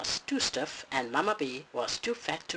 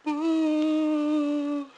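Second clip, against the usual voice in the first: much higher loud wail; mock crying boo; 11 semitones higher, 7 dB louder than usual. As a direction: wail like a sobbing cub. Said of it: "he cried".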